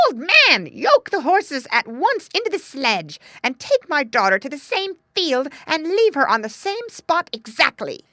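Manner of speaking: high, loud, urgent order; calling out. A narrator's reading. Greedy stepmother to her husband, high loud calling. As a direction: call out commands at full volume. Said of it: "she called to her husband".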